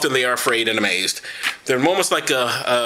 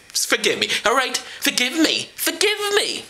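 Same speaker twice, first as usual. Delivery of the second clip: mock pleading; 9 semitones higher than usual. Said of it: "pleading to viewers".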